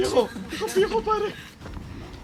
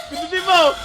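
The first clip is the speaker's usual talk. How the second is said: shouting; 4 semitones higher, 8 dB louder than usual. Real, not acted. Urging teammate loudly.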